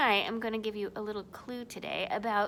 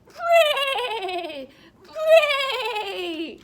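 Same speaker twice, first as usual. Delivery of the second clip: loud high falling bray; zebra bray; 12 semitones higher, 9 dB louder than usual. Shown as a demonstration. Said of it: "How does a bray sound like?".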